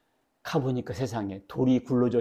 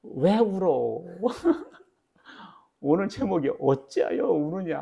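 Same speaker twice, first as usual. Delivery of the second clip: put-on higher, very slow coaxing to a crying baby; grandpa soothing; 5 semitones higher, slower than usual. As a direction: gently ask the baby why it cries, with a chuckle. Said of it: "내가 뭐라고 했겠어요".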